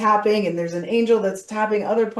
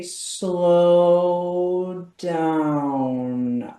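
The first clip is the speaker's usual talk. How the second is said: stretched, slow model take; really slow demo. Shown as a demonstration. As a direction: draw each word out. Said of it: "I want you to say it really slow".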